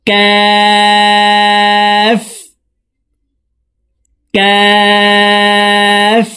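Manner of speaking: কাফ chanted very slowly and high, tongue a little forward; long forward কাফ; kaf further forward. Shown as a demonstration. Teacher demonstrates kaf for viewers. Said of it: "জিহ্বার গোড়া থেকে একটু আগে বাড়িয়ে".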